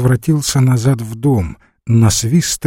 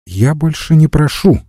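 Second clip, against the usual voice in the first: raised pitch, mildly loud reply; speaking out loudly; 6 semitones higher than usual. A narrator's reading. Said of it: "ответила ей громко Лариса". (громко, повышенным тоном)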